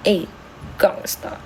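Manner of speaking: breathy whispered street greeting; low whispered tough-guy hello; whispered gangsta. Pretend. Mimicking street slang greeting.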